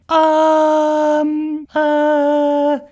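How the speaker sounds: loud, long-held filler sounds; awkward hesitation. Acted. Unicorn facing a silent staring playground, awkward hesitation. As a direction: stretch each filler out awkwardly.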